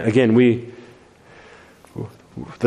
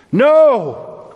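cried out; 11 semitones higher, 3 dB louder than usual. A narrator's reading. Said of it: "cried Gandalf".

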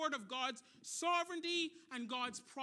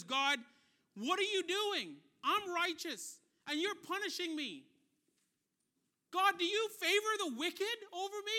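loud, raised-pitch cry of protest at God; frustrated yelling; 6 semitones higher, 7 dB louder than usual. Retold or imitated. Job protests his punishment to God, frustrated yelling. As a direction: act out an indignant, high, forceful complaint. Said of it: "And he's yelling at God".

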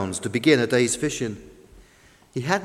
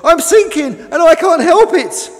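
high, loud wail of panic; screaming; 13 semitones higher, 10 dB louder than usual. A narrator's reading. New boat goes down, screaming in panic. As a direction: cry out helplessly at full voice. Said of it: "screamed the new boat".

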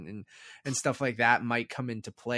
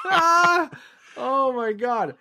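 laughing hard; 14 semitones higher, 10 dB louder, much slower than usual. Real, not acted. Bursts out laughing.

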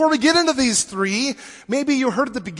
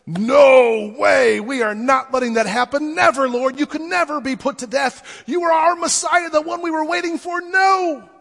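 protesting Peter; 5 semitones higher, 4 dB louder than usual. Retold or imitated. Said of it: "good old Pete ... says".

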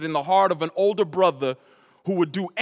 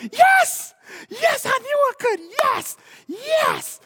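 high, squealing yes, much higher and louder; sister celebrating; 6 dB louder, much slower than usual. Retold or imitated. Little sister celebrating her win, squealing high. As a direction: cheer like you just won the prize. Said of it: "I'm gonna say".

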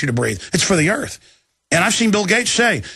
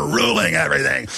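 normal talking voice

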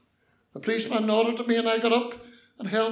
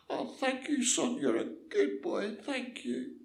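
put-on frail little voice of a thankful old woman; grateful old lady; 5 semitones higher than usual. Old lady thanking the lad who reassured her, grateful.

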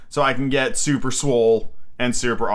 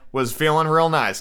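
ordinary talk

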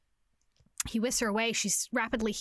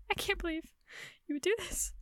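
higher, softer voice, imitating tears; retold tearful disbelief; 7 semitones higher, 5 dB quieter than usual. Heroine answers her apologetic lover, retold tearful disbelief. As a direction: lightly act a tearful, hushed higher voice.

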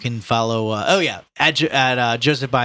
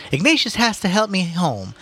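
mother voice; 10 semitones higher than usual. Acted. Mrs Reilly voiced in reading.

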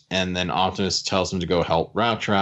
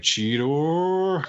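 drawn-out, higher mock-upset call; upset Optimus; 9 semitones higher than usual. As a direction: imitate an upset leader calling out the name. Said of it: "is upset at first".

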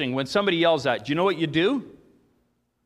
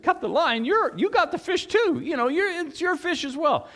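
much higher-pitched acted protest; other fisherman; 12 semitones higher than usual. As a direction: argue back in a high voice as the other angler. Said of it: "Another said".

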